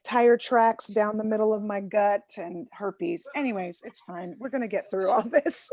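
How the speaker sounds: ordinary delivery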